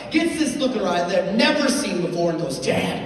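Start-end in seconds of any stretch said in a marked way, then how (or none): 2.6-3.0 slow, menacing mock-possessed child; comic demon-child demand; savage kid. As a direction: drag the words out like a little monster. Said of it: "starts foaming from the mouth".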